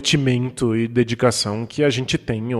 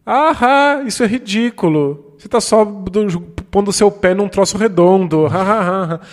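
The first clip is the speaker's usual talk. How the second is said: higher-pitched jeering voice with fake laughter; heckler mocking from sidelines; 5 semitones higher than usual. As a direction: imitate a spoilsport taunting the players, ha-ha and all. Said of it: "alguém ficar do lado de fora gritando assim". (zombaria gritada de desmancha-prazeres)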